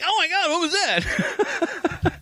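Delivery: high, slow, laughing horrified voice; mock shock. The algorithm shows something awful, slow high laughing shock. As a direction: drag it out, half laughing in horror. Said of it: "and then it'll be like".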